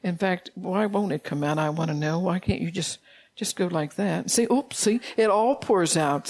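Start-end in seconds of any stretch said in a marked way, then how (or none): none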